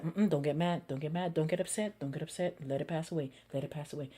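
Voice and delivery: soft, low calming words to yourself; quiet self-talk. Retold or imitated. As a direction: hush yourself down, low and gentle. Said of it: "this is why I say to myself".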